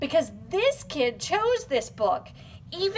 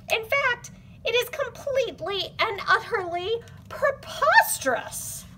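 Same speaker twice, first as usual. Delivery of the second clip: mock indignant; 9 semitones higher than usual. Mock outraged reader.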